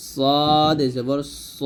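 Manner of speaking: slow, heavy s with rounded o in "সদ জবর স"; thick sad. Shown as a demonstration. Teacher demonstrating the letter sad, heavily pronounced "সদ জবর স". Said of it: "মোটো হবে".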